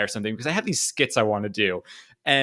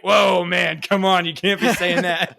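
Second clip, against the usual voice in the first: louder, higher, indignant shout; offended Gimli; 6 semitones higher, 4 dB louder, slower than usual. Pretend. Gimli shouting back at Aragorn, indignant. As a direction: call out the insult loudly. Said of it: "He's like".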